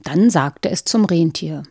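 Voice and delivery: ordinary delivery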